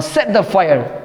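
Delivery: ordinary delivery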